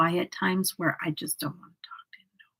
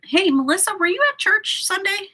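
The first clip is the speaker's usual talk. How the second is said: high, friendly call-out to a viewer; high-pitched greeting; 13 semitones higher, 4 dB louder than usual. Real, not acted. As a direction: call to a friend across the room, high and louder. Calls out to a chat viewer.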